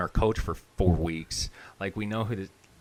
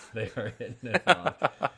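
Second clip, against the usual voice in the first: higher, laughing agreement; 4 semitones higher than usual. Real, not acted. Co-host agrees about the mom and coach, laughing. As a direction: laugh through the words. Amused repeat with laughter heard.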